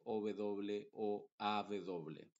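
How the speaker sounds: normal talking voice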